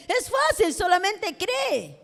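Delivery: high-pitched, drawn-out encouragement; urging, retold. Believers urging her to simply believe, high-pitched and coaxing. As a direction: imitate someone making it sound easy, pitched high.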